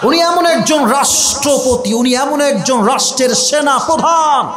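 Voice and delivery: high, ringing oratory; preaching at full force. Real, not acted. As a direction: proclaim to a crowd and demand assent. (জোরালো ওয়াজের উচ্চকণ্ঠ)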